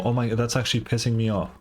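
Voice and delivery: ordinary talk, nothing marked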